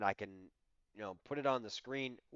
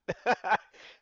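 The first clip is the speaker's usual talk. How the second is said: short laugh; 8 semitones higher, 7 dB louder than usual. Real, not acted. Short laugh after anger.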